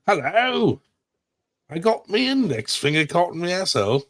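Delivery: high, put-on silly accent voice; comic character voice. Host clowning after accent talk, high comic character voice. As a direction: squeal it out in a high mock accent.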